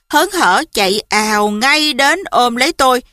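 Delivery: ordinary delivery, nothing marked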